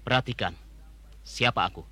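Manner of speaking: low and soft, threatening; menacing low voice. Acted. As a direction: make him look closer.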